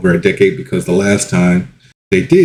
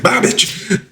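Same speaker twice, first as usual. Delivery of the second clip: high whispered mock goodbye; high breathy sneer of a send-off; sassy mock farewell; 3 dB quieter than usual. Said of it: "everyone's like".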